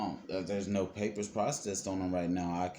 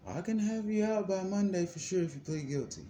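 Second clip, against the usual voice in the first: mocking the lawyer; 8 semitones higher than usual. Lawyer voiced promising release.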